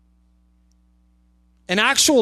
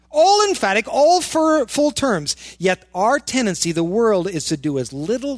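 normal talking voice

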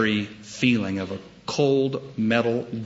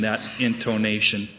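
usual speaking voice

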